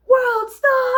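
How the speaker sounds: high, loud, laughing yell; a laughing, imitated crowd yell; shouted World Star. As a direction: shout it like a bystander filming a fight. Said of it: "somebody would scream".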